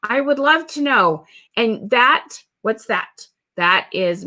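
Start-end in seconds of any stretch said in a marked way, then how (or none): 0.0-1.2 high, bright eager yes. Retold or imitated. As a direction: gush like a keen customer. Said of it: "ninety percent of them are gonna be".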